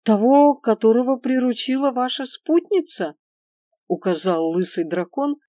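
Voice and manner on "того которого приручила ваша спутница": eager merchant. A narrator's reading. Merchant answers dragon eagerly.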